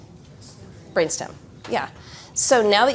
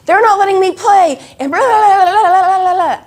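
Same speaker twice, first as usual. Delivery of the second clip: put-on high, loud complaining kid voice; a child whining to the teacher about the playground; whiny tattling kid; 12 semitones higher, 10 dB louder than usual. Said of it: "on the playground".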